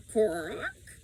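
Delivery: a lightly voiced croak; raven croak. A narrator's reading. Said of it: "he croaked".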